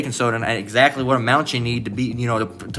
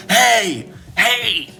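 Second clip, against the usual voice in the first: high, mock holler; mocking high-pitched shout; imitated yelling; 9 semitones higher than usual. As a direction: call out "hey" like someone yelling in public. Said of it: "fucking Jake just yelling".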